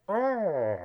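high mock groan of agony; badly faked, rising-and-falling moan of pain; hammed-up agonized "oh"; Bane. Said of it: "that's Bane in agony".

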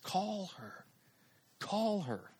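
soft, spaced-out prompt; quiet inner nudge. Retold or imitated. The thought interrupts his excuses, softly insistent.

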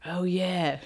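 lightly put-on British accent; a hint of a mock British lad; Oliver Twist British. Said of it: "he's gonna sound kinda like Oliver Twist".

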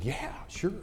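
very soft, retold boy's answer; hushed reply. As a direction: answer under your breath, imitating a boy. Retold furtive exchange between two boys.

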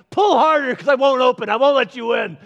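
a playground taunt at the door; taunting God. Retold or imitated. Preacher voicing a stubborn God, high and loud. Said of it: "and be like".

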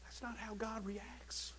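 slow hushed whisper. Acted. Preacher correcting the congregation, slow whisper. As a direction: drop it to a whisper. Sudden drop after shouting.

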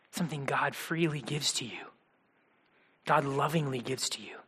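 soft intimate whisper. Real, not acted. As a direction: lean in and whisper it. Tender whispered gospel line.

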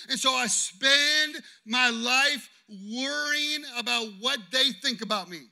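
loud, impassioned sermon delivery, punching key words; preaching at full force. Acted. Pastor preaching to his congregation about rejection, loud and impassioned.

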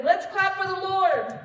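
loud, high rallying cheer; worship leader's call. Shown as a demonstration. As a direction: rouse a congregation to clap. Demonstrates worship leader's prompts.

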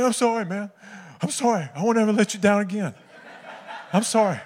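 high, hammed-up crying voice; mock tearful apology. Teammate apologizing to his friends, mock tearful. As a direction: wail it in a comic tearful pitch. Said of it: "I just had tears streaming down my face".

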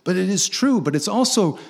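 ordinary delivery

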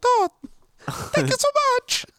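put-on very high falsetto Italian accent; mock Italian voice. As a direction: gush in a squeaky cartoon Italian accent. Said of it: "That's how they all talk".

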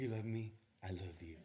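soft, fading lovey phone talk; lovestruck murmur. Retold or imitated. Teenage sweethearts cooing on the phone, soft murmur.

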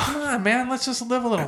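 high-pitched, wheedling retold plea; coaxing plea. Friend urging nervous girls over the railing, playful coaxing. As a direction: coax them, pitch right up.

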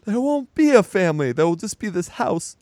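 high, loud, desperate outburst at her husband; hammed-up furious plea; pissed-off wife. Wife yells at husband that the family is breaking up, high and loud. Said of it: "she's already pissed".

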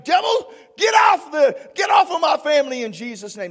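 loud, fast rebuke of the devil; commanding the devil. Shown as a demonstration. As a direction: order him out with authority. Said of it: "whatever you demand in my name".

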